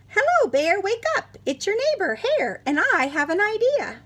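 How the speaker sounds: high, loud and chirpy; eager Hare voice. Acted. Hare calls his sleeping neighbour Bear awake, high and loud. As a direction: call out brightly to rouse a sleeping bear.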